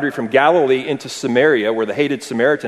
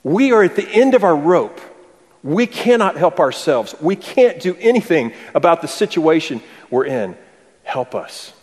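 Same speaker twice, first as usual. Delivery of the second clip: desperate plea; 6 semitones higher, 3 dB louder than usual. Retold or imitated. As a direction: beg for help as if at the end of your rope. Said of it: "what they are saying is".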